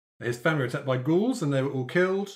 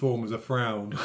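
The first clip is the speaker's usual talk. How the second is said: normal talking voice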